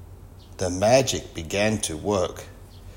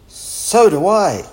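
high, loud, slow exclamation; keen agreement; 10 semitones higher, 8 dB louder, slower than usual. A narrator's reading. Wilf also loves strawberries, keen agreement. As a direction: chime in eagerly, stretching the words.

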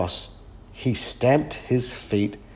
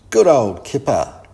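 loud, higher villagers' cheer; called out; 4 semitones higher, 7 dB louder than usual. A narrator's reading. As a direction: call out praise, raised voice. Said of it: "everyone called".

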